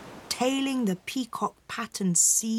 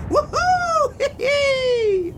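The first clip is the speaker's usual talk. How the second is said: high, loud whoop into a giggly squeal; excited whoop; 7 dB louder, slower than usual. A narrator's reading. Mother swinging in a basket from a kite string, excited whooping. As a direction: whoop wildly, pitched way up, ending in a squeal.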